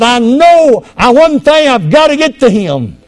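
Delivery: high, insistent preacher's cry; acting out a man desperate to break free; determined cry. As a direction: belt it out with urgent resolve. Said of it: "But he said".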